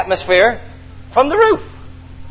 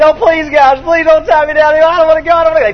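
higher, louder desperate begging; pleading; 7 semitones higher, 3 dB louder than usual. Acted. Fred begging friends not to tie him, high pleading. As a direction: plead like a frightened man being tied down. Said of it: "Fred's pleading".

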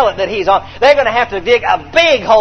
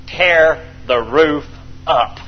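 slow dramatic emphasis; 6 semitones lower, 4 dB quieter, much slower than usual. Acted.

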